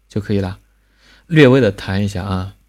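usual speaking voice

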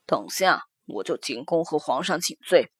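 calm, deep-set official father. Acted. Same father voice.

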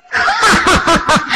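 high booming ha-ha-ha; hearty laugh. Acted. Old king laughing at the kneeling Zhang Kun, hearty laugh.

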